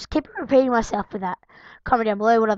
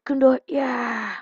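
silly put-on voice. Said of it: "I'm talking in this voice".

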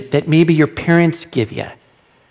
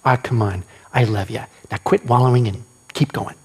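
gentle fatherly coaxing; 7 semitones lower, 4 dB quieter than usual. Retold or imitated. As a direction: lower and softer, tender nudge. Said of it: "the same thing that I do to my kids".

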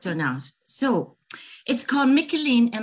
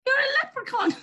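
high laughing exclamation of wonder; delighted surprise; much faster than usual. Acted. Girl meeting a leprechaun, high delighted laughing exclamation. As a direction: squeal it with a laugh of disbelief.

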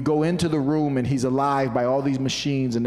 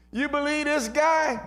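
high-pitched mock disbelief; sarcastic scoffing; 14 semitones higher than usual. Doubters scoffing at Jesus among themselves, sarcastic disbelief. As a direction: scoff as one of the doubting crowd. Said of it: "they laughed sarcastically".

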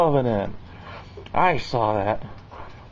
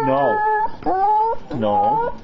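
high squeaky no; 4 dB louder than usual. Real, not acted. Refusing the dog, heard cry.

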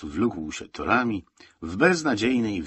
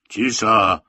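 short, loud command for silence; loud acted shout; orderly's yell; 9 dB louder than usual. A narrator's reading. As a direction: yell it out to hush a tent. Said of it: "wrzasnął dyżurny".

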